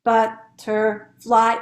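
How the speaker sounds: "butterfly" very slowly, broken into three beats; counting out syllables. Shown as a demonstration. Teacher counting with pupils, "butterfly" in separated syllables. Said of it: "and then let's count".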